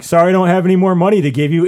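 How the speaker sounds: fast, loud mocking whine; mock sorry voice, quick and loud; mocking friends. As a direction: mimic them brushing him off. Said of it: "and you're just like".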